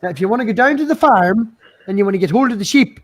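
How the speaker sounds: hammed-up rural Irish accent. Shown as a demonstration. Streamer demonstrating farm and pub talk, in an Irish accent. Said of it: "That's an Irish accent".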